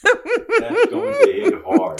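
slow, high, drawn out through laughter; laughing tease. Real, not acted. Host teasing the mother about the jab, laughing slowly. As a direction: stretch it out while cracking up.

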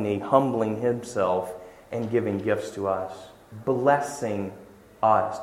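quiet awe at a humble God; tender hush. Real, not acted. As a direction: let it fall to a reverent hush. God humbling himself, tender climax.

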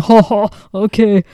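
loud, slow, dopey alien husband; mock alien voice. Wife mocking husband as an alien, loud put-on voice. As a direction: play a mindless alien taking an order, loudly. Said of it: "what he would sound like if he was an alien".

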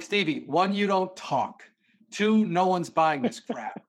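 hammed-up dad counting off reasons; retold scolding dad.

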